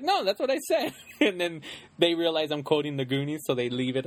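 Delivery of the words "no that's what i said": insistent retort. Acted. Acting out covering a slip.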